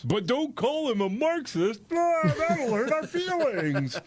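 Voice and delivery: high-pitched, drawn-out mock whine. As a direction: play the wounded crybaby, hammed-up. Mocking touchy political opponents' complaint.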